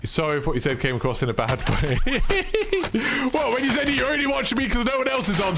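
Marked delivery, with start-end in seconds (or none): 2.0-3.3 high-pitched amused laugh; real laugh. Real, not acted. Streamer amused by a viewer's chat message, real laugh. 3.3-5.5 high-pitched, amused protest; amused disbelief. Real, not acted. Streamer answering chat's backhanded remark, amused disbelief.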